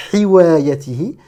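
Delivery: "hiwayatihi" opened with a pharyngeal, rubbed h; wrong raspy ha. Shown as a demonstration. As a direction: make the first h of "hiwayatihi" a constricted throat fricative. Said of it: "Ce n'est pas un ha".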